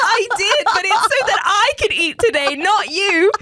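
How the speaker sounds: indignant retort. Retold or imitated. Snapping back at the scammer who wants her lunch money, loud mock outrage. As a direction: tell him off, half outraged half amused.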